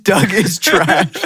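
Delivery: "Doug is trash" drawn out slowly, each word weighted; slow imitated Dracula verdict; slow deadpan. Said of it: "It's the way he said".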